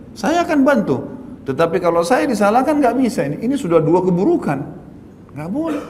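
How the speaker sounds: firm refusal. Retold or imitated. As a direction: stand your ground, refusing the blame. Preacher retells his firm stand.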